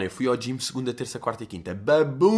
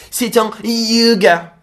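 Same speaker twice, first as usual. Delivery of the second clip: put-on French accent, much higher, louder and slow; French yoga; 9 semitones higher, 8 dB louder, much slower than usual. Mocking the French translation with a hammed French accent. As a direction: ham up a French speaker. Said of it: "E em francês?".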